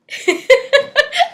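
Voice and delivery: real high-pitched laugh bursting out; high burst of laughter. Real, not acted. Laughing at the memory of the manager scolding her.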